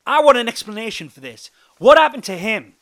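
loud, high-pitched demand for answers; angry demand. A narrator's reading. Joe demanding an explanation about the injured narrator, loud and angry. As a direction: demand it at near-shouting force.

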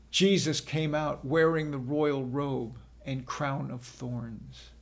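ordinary delivery, nothing marked

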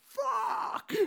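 mock-exasperated rant at the old lady; exasperated outburst. Retold exasperated inner reply.

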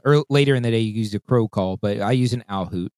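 ordinary talk

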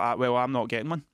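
laughing it off; laughing shout of refusal. Retold or imitated. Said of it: "And I was like".

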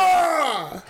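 high shouted oh; excited shout. Real, not acted. Hearing a friend's silly sound idea, high excited shout. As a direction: yelp in delighted surprise. Reacting to the torpedo joke.

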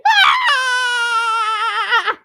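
high loud mock scream; scream of fear. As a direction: shriek like a scared kid. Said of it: "He was going like".

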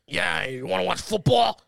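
loud, slow mock-raspy sales pitch; exaggerated smoker's rasp. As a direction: imitate a raspy chain-smoking salesman. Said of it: "super raspy".

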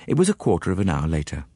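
ordinary delivery

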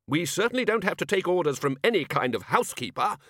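high, loud, defiant bluster; blustering wizard. A narrator's reading. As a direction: puff up and declare it. Ridcully blustering.

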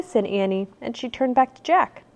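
ordinary delivery

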